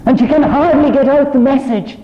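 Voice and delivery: high, loud, ringing proclamation; full-force preaching. Acted. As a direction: lift the whole room with the joy of it. Preacher proclaims Mary's message.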